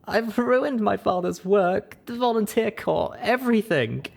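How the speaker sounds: high, tearful voice; weeping. Acted. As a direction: weep it out in gasps. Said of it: "wept with tiny little gasping sobs".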